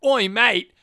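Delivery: broad Australian call; Australian accent. Shown as a demonstration. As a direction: punch out an Australian greeting. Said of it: "aggressively Australian at times".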